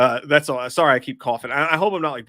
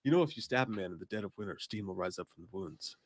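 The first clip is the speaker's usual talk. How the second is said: low spooky quote; 5 semitones lower, 9 dB quieter than usual. Retold or imitated. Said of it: "Mark, uh".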